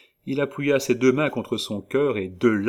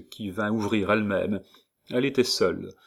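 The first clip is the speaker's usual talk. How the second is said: plain reading voice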